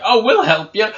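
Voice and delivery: high, loud mock offer of help; mocking imitation. Mimicking the player's earlier offer.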